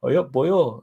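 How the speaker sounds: cheeky Welsh accent impression. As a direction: toss off a playful Welsh accent. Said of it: "A Welsh accent".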